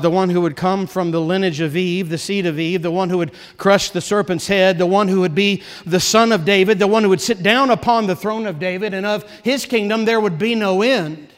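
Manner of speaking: ordinary talk, nothing marked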